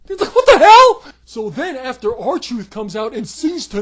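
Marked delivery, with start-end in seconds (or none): 0.1-1.0 loud, higher, laughing what the hell; laughing disbelief. Real, not acted. Reviewer laughing at the silly exit, loud. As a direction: laugh out the outrage.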